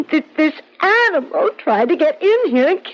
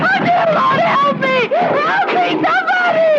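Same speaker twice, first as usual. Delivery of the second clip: hysterical screaming. Acted. Woman trapped by a beast screaming for help. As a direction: scream in full panic as it breaks in. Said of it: "heard her cries for help".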